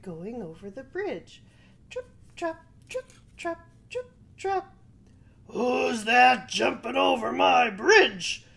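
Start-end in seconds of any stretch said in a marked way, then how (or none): 1.9-4.7 light hoof steps. Acted. Bridge footsteps sound effect. 5.5-8.4 loud, slow, menacing troll. Acted. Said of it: "said the troll".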